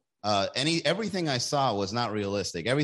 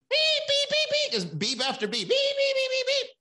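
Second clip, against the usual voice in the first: high imitated censor beeps. Shown as a demonstration. Imitating TV censor beeps over cursing. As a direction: beep it out like TV censoring. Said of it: "you can't curse".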